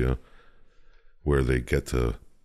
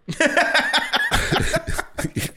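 high burst of laughter. Real, not acted. Laughing at killing cops.

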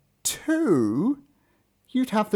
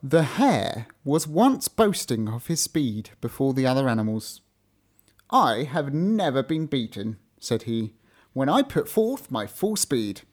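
story narration; 3 semitones lower than usual. A narrator's reading. Fable read aloud.

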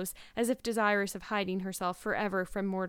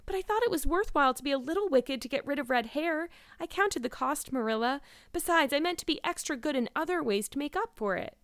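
put-on higher-pitched, earnest girl making excuses; self-justifying girl; 7 semitones higher than usual. A narrator's reading. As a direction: plead your case to a stern guardian.

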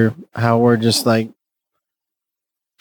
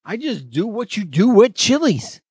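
mock partier; 9 semitones higher than usual. Same mock partier voice continues.

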